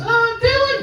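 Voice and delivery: put-on very high-pitched little boy voice; kid's voice. Host family's son knocking at comedian's door, high kid voice. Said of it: "he's a kid, that's how he talks".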